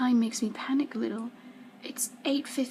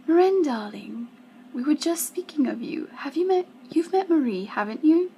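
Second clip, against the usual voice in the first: higher, louder society-hostess chatter; sociable mother; 6 semitones higher, 6 dB louder than usual. A narrator's reading. Mother introducing her daughter to a friend, effusive and sociable. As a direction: gush at your daughter in company.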